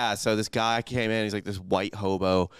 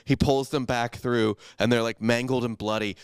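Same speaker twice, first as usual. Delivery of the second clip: ordinary delivery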